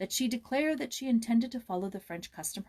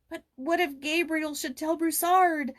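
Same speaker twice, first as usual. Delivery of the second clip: put-on high, slow, fearful boy's question; a frightened boy almost shivering; a boy trembling with worry; teeth chattering; 9 semitones higher, 6 dB louder than usual. A narrator's reading. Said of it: "his teeth almost chattering".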